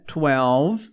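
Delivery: twelve ending on a curled L; English twelve. Shown as a demonstration. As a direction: say twelve letting the L curl back. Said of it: "E esse L dá aquela volta". (twelve com o L enrolado no final)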